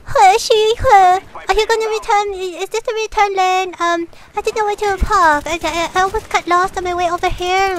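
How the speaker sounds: high-pitched mock Chinese accent; strong Chinese accent. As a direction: imitate a customer in a high, exaggerated Chinese accent. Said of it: "in that strong accent".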